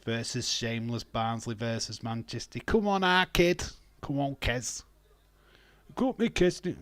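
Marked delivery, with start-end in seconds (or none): 2.6-6.8 rally them on; excited cheering. Real, not acted. Streamer cheering Kez in the match.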